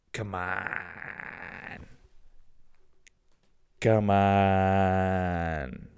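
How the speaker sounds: slow echoing come on; cave echo. Shown as a demonstration. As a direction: let it ring like in a cave. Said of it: "That's never gonna get old".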